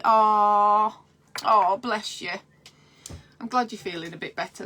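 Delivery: ordinary delivery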